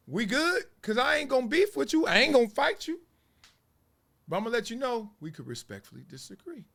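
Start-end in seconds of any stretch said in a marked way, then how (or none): 0.1-3.0 high-pitched, lightly acted peace offering; acted reassurance. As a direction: pitch it up, disarming a would-be rival. His acted peacemaking reply to a hater.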